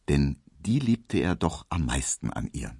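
ordinary delivery

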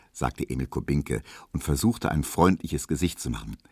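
ordinary delivery

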